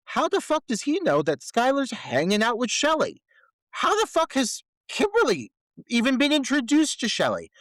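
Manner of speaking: high-pitched, exasperated disbelief; incredulous outburst. Real, not acted. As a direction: demand an explanation for the glaring plot hole. Incredulous rant about a plot hole.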